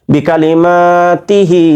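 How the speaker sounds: বিকালিমা তিহি with মা and হি held long; quick short syllables, stretched মা and final হি; one-alif elongations. Shown as a demonstration. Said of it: "এক আলিফ টান, তিহি এক আলিফ টান". (বিকালিমা তিহি-তে মা ও হি টেনে পড়া)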